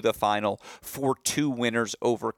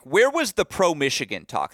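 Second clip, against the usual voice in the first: mock yelling; 6 semitones higher, 4 dB louder than usual. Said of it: "they both yelled at me".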